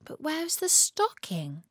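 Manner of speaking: high, puzzled question; wondering. A narrator's reading. Natalie looking for her stocking, wondering. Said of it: "she wondered".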